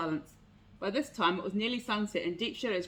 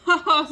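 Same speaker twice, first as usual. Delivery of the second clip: much louder and higher sarcastic "ha ha"; mock laugh "ha ha"; 8 semitones higher, 13 dB louder than usual. A narrator's reading. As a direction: fake a scornful laugh. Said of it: "laughed the fox".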